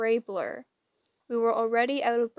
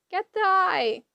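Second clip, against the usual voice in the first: much higher screeching hag; 8 semitones higher, 4 dB louder than usual. A narrator's reading. Grey sister ordering Percy to get the eye.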